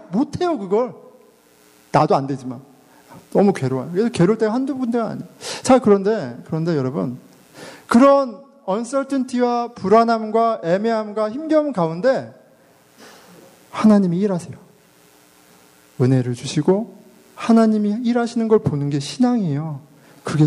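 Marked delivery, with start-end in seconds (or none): none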